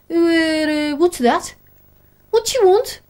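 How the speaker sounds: jolted awake, confused; just woken up. Acted. Sleepy Pepper woken up.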